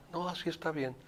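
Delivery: retold people-pleasing refusal; complacent patient. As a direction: imitate a patient meekly backing off.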